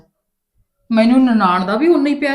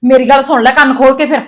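much louder, higher warning; furious threat; 3 semitones higher, 8 dB louder than usual. Acted. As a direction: lay down the law in a rage. Mother threatens daughter, loud. (ਗੁੱਸੇ ਵਿੱਚ ਉੱਚੀ ਧਮਕੀ)

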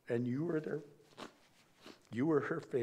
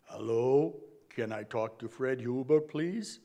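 loud, gruff disguised prank-call voice; a louder, gruffed-up voice pretending to be someone else; 6 dB louder than usual. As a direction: bark it down the phone like a stranger. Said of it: "in a very gruff voice say".

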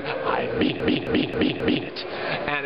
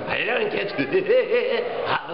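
silly clown; 9 semitones higher, much slower than usual. Retold or imitated. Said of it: "This is before Krusty".

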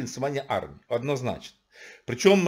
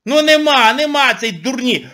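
loud exasperation; 9 semitones higher, 8 dB louder than usual. Real, not acted. Ranting at audience.

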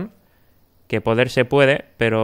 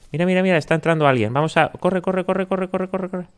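hushed urgent, rising pitch; urgent hurry; 7 semitones higher than usual. Real, not acted. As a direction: rush them along in a hurry. Burglar hurries as someone enters. (apurado y urgente)

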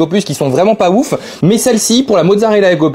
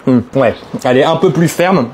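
talking while chewing; mouth full; 4 semitones lower than usual. Real, not acted. Seen 1: Bites at -1.0, chews with lips pursed while speaking until ~1.9.

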